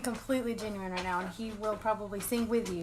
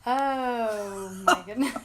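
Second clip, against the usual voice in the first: breaking into loud laughter; can't hold back the giggles; words burst out through real laughter; laughing fit; 5 dB louder, much slower than usual. Real, not acted. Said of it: "Can you pull yourself together?".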